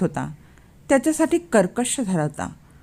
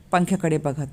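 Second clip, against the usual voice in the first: ordinary delivery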